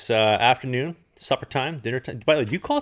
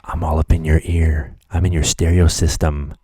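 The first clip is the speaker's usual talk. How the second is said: creepy teasing; 4 semitones lower, 6 dB louder than usual. Pretend. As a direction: lean in like a stalker joking. Creepy host teasing listeners.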